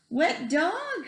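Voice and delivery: high mock cry; cried out. A narrator's reading. The lady with pink blooms crying at the wet dog. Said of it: "cried the lady".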